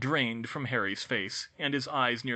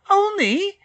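sudden high, loud shriek of disbelief; 13 dB louder than usual. A narrator's reading. Hermione shrieks at Harry in disbelief, high and loud. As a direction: shriek the word in alarm. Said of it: "Hermione shrieked".

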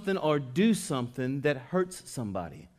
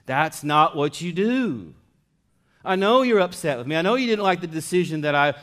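louder, driving sermon voice; full force preaching; 5 semitones higher, 8 dB louder than usual. Acted. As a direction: preach it hard to the congregation. Preaches loudly on confession.

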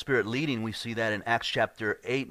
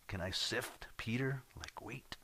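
half-whispered, much softer; hushed request; 11 dB quieter than usual. Acted. Satan asks the Lord to sift Peter, hushed. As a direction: drop to a near whisper, as Satan asking.

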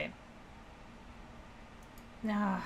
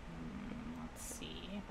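whispering; 14 dB quieter, much slower than usual. Real, not acted. Muttering to self in maze.

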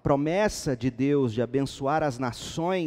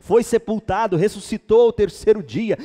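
raised voice building to loud, high proclamation; building preaching; 5 semitones higher, 7 dB louder than usual. Real, not acted. Preacher recounting Christ's life and resurrection, building full-force preaching. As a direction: build the gospel summary to a climax.

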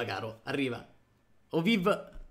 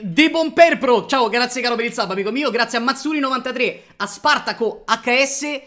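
loud, high, rapid thank-you roll call; streamer shout-outs; 5 semitones higher, 7 dB louder than usual. Real, not acted. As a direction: belt out the sub thanks to chat. Reads out sub thanks.

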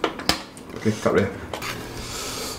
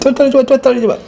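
excited urging; 12 semitones higher, 6 dB louder, much faster than usual. Real, not acted. Urging pull of stretchy cheese.

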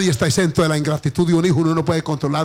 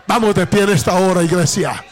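raised, ringing call to praise; fervent preaching; 5 semitones higher, 4 dB louder than usual. Acted. Pastor urging the church to stand and give thanks, fervent preaching. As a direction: lift the congregation with rising fervour.

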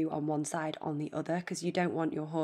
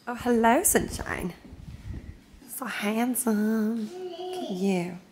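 slow, high cooing voice; 4 semitones higher, 5 dB louder, slower than usual. Real, not acted. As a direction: coo lovingly at your horse. Cooing over her horse.

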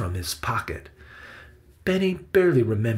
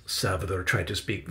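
plain reading voice

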